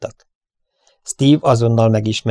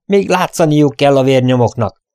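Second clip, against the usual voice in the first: loud, insistent cry; raised voice, pitch up; shouted; 5 semitones higher, 6 dB louder than usual. A narrator's reading. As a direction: call it out with urgency. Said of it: "kiáltotta közbe".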